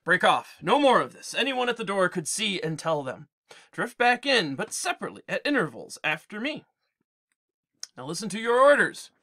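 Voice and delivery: a hint of a captain's command; Odysseus ordering the herdsmen inside; Odysseus giving orders.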